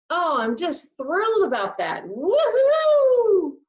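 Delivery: over-the-top fake enthusiasm, squealing high and loud on woohoo; sarcastic cheer. Shown as a demonstration. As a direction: gush with mock delight, hitting a shrieking woohoo. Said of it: "I'm being sarcastic".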